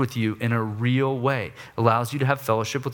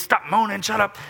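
put-on griping at kids; 7 semitones higher than usual. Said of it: "griping at the kids".

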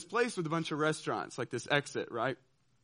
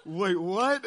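higher, louder double-take; startled disbelief; 4 semitones higher, 6 dB louder than usual. Retold or imitated. Him reacting to his cheering teammates, startled disbelief. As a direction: catch yourself in disbelief. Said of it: "And I'm like".

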